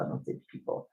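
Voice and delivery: whispered confession; whispered aside. Real, not acted. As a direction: drop to a whisper. Muttered aside to self.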